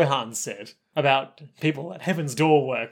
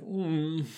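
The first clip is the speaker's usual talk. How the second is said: mock whimper; 10 dB quieter than usual. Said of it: "what was that sound".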